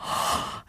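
quick surprised intake of breath; a retold gasp of shock; sharp gasp. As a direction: gasp in disbelief. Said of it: "And I was like".

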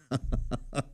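quiet little laugh; brief chuckle under the breath; soft chuckle. Real, not acted. Uncle recalling impatient young anglers, soft chuckle.

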